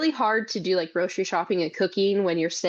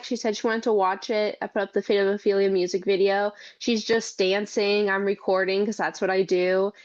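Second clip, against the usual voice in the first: ordinary delivery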